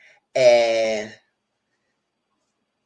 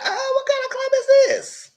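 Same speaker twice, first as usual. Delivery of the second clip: squealed falsetto disbelief; high-pitched mock shock; high shocked exclaim; 4 dB louder than usual. Retold own shocked reaction.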